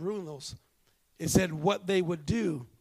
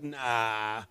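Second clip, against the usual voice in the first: dismissive; 8 semitones lower, 4 dB louder, slower than usual. Retold or imitated. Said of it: "you'd be like".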